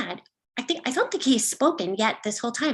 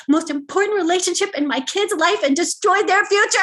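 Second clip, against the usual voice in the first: spiralling panic; 2 semitones higher than usual. Acted. Parent imagines ruining the kid's future, panic spiralling upward. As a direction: race into catastrophe, rising at the end. Catastrophising after misgendering the kid.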